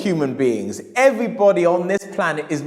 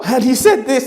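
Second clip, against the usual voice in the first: higher, louder, laughing; amazed whisper-shout; 8 semitones higher, 6 dB louder than usual. Retold or imitated. Customer marvelling at the book's claims, amazed. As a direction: burst out in amazement.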